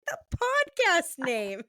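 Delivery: high, drawn-out mock exasperation; retold exclamation. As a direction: stretch it out high, as if it were obvious. Retold explanation to puzzled husband.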